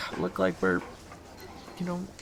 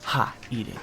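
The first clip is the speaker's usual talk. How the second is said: ordinary delivery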